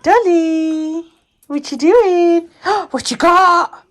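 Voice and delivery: high, slow coo; high cooing question to Dolly. Real, not acted. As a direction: ask the dog what she found. To dog.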